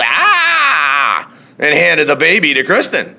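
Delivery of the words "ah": high yelp with a laugh in it; gorilla yell. A narrator's reading. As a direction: yelp from a nose bite. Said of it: "the gorilla yelled".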